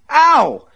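sharp high yelp of pain; pained scream. Acted. Myrtle yelps as Buster seizes her hair, high pained cry. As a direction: cry out as your hair is yanked. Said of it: "she screamed".

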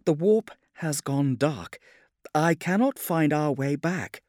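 high, slowed voice of a frightened navigator; fearful report, pitch held high; afraid. A narrator's reading. Navigator admitting she cannot find the way back, afraid. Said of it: "Fianna sounded afraid".

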